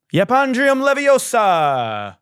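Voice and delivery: slow, high, loud spell incantation; mock spell. Mock casting a Harry Potter spell, slow and high. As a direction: cast it like a wand spell. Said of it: "wizard spell".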